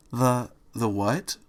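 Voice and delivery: slow, hesitant puzzled question; falter, not understanding; halting confusion. A narrator's reading. Fluttershy puzzles at Angel's phrase, slow and halting.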